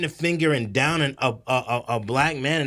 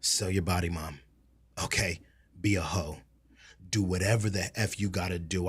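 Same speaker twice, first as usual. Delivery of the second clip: a hushed aside; whispered; 10 semitones lower, 8 dB quieter than usual. Real, not acted. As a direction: whisper it under the breath.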